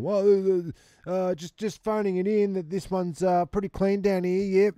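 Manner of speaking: phone it in like a cheery worker; mock phone report.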